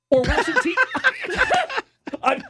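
talking through high laughter; laughing. Real, not acted. Host laughing at his own joke to co-hosts. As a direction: laugh the words out, high and loud. Laughing about Wilson t-shirt.

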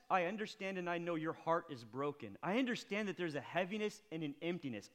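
normal talking voice

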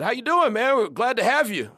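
warm coach welcome. Retold or imitated.

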